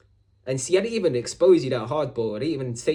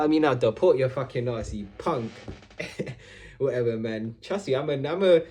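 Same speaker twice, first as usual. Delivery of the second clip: usual speaking voice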